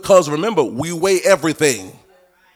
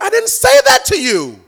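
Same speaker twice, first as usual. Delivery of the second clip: much higher, squeaky mock denial; high protest; 5 dB louder than usual. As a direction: deny it in a high put-on voice. Falsely blamed believer protesting.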